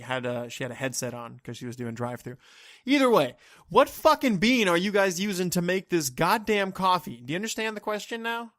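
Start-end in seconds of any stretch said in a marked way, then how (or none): 2.8-8.5 loud, heated rant at a barista; exasperated. Acted. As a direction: demand an answer, raised voice and exasperated. Imagined angry question to baristas.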